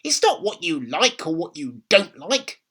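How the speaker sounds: high, loud snapping grandma; grandma snapping at George; snapped. A narrator's reading. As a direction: snap it back at the boy. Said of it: "Grandma snapped".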